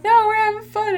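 high, whiny protest; high mock whine. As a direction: whine like a crowd wanting to stay. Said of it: "everyone's like".

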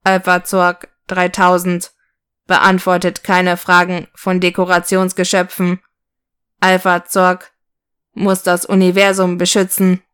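monotone, stiff robot voice; even pitch, chopped into separate phrases; self-important robot. Acted. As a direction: announce it like a pompous toy robot. Robot Zorg answers the sock.

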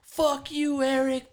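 high mock yell across the bar; shrill-pitched imitation of a girl yelling; retold yell. Girl yelling at Eric outside the bar, mock yell. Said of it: "she just yells out".

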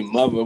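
"mother" pronounced "muvver". Shown as a demonstration. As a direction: demonstrate "mother" with a V in the middle. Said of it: "with a V".

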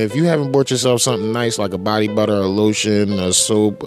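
ordinary delivery

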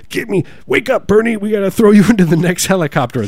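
high, loud comic alarm; urgent mock yell. Aide waking Bernie to switch helicopters, high urgent mock yell.

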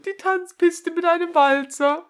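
loud, high mocking read; mocking emphasis. Real, not acted. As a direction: ham up the silly word. Spottet über das Wort Tanzpiste. (spöttisch betont)

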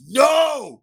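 loud high scream. Acted. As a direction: scream it in fury.

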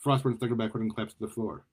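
usual speaking voice

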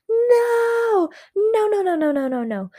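put-on high frantic child's cry of no; yelling no. A narrator's reading. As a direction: wail it out like a panicked kid.

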